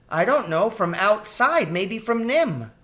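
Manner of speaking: put-on high-pitched child guessing warily; piping little-girl suspicion; suspicious girl rat. A narrator's reading. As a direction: speculate in a high, young voice.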